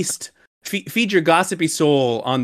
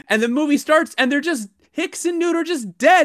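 climbing higher with mock outrage; rising indignation; 10 semitones higher than usual. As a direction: let the disbelief build up higher. Retelling outrage, pitch climbs.

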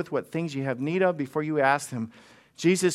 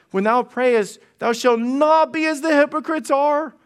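louder, higher, stress on not; emphatic scripture reading; 8 semitones higher, 4 dB louder than usual. Shown as a demonstration. Pastor quoting verse five to the congregation, raised and emphatic. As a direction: proclaim the verse with force. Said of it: "in verse five".

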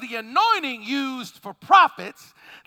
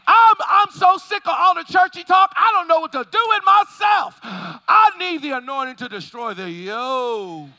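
louder and higher, yelling in frustration; full-force preaching shout; 4 semitones higher, 4 dB louder than usual. Real, not acted. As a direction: let it erupt from the pulpit. Preacher erupts at churchy talk.

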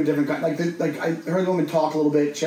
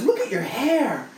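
creepy cooing woman; 8 semitones higher, slower than usual. Retold or imitated.

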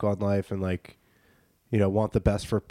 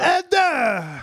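mock shout; 4 dB louder than usual. Joining the mock voice.

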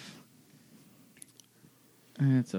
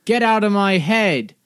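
shouting; 7 semitones higher, 7 dB louder than usual. Acted. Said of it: "And I say".

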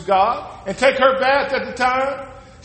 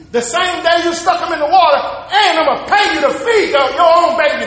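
preaching shout; 4 semitones higher than usual. Real, not acted. Preacher shouting the payoff to the congregation. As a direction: build and shout it out.

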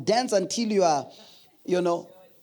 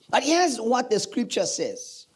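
preaching; 4 semitones higher, slower than usual. Acted. Preaching close.

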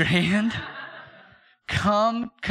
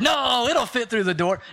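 overconfident insisting; 6 semitones higher, 4 dB louder than usual. Retold or imitated. Insisting the crib fits.

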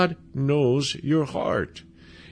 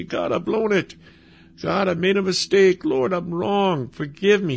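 raised-pitch acted prayer of repentance; pleading confession; 6 semitones higher than usual. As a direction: voice a sinner owning up to God, pitched high. Voicing a repentant prayer to God.

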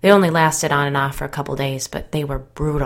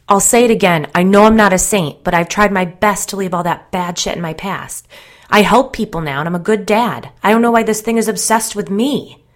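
raised, loud, heated self-justification; defensive protest; 5 semitones higher, 9 dB louder than usual. A narrator's reading. Narrator insisting on a reformed past, defensively. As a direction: plead your case loudly, pitched up.

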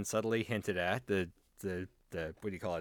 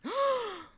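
mock gasp. Mock shock at the spoiler.

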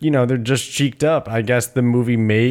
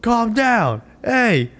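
high-pitched mock calling out, each phrase falling; high, repeated pleas without real shouting; mock coach protesting; 10 semitones higher than usual. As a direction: imitate a useless coach calling for calm. Coach ineffectually calling out stop.

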